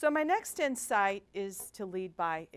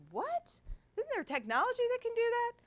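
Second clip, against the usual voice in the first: much higher, fast, astonished question; incredulous disbelief; 3 dB louder than usual. Retold or imitated. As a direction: ask it as if you can't believe it. Incredulous retold question to staff.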